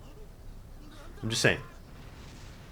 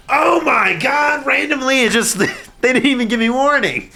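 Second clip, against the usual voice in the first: much higher, loud, stretched shocked shout; 12 semitones higher, 5 dB louder than usual. Real, not acted. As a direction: yell in disbelief at the screen. Shocked by sudden scene cut.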